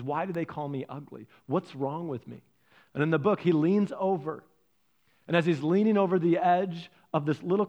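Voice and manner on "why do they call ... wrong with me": soft, low, hurt questioning; a touch of acted sorrow; quietly dejected duckling; tearful. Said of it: "he's crying".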